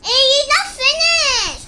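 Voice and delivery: high, loud cry of protest. Real, not acted. Child protesting to parent that play isn't finished, loud. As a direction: yell it, the game isn't over.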